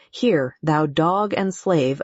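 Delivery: ordinary delivery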